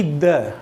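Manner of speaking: "द" with a neutral schwa vowel; "द", the pre-consonant form of "the". Shown as a demonstration. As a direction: say "द" as an unstressed duh. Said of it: "एक होता है टीएचई".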